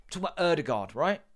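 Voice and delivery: ordinary talk, nothing marked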